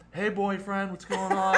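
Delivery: high, loud mock-flirty holler; teasing call-out.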